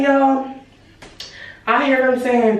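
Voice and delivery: usual speaking voice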